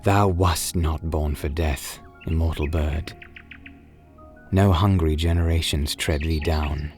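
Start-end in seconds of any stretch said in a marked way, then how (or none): none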